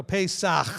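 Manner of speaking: Pesach ending in a throaty "kh"; guttural ending. Shown as a demonstration. Teaching the Hebrew sound, Pesach with guttural ending. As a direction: say PAY-sakh, rasping the last sound of Pesach. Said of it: "just clearing the throat thing".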